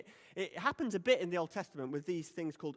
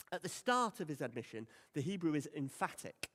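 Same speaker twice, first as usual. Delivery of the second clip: ordinary delivery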